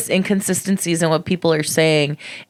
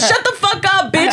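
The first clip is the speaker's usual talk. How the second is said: high, sharp mock snap; mock snapping; 13 semitones higher than usual. Comic retelling her stage retort, high mock snap. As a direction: bark it in a squeaky mock voice.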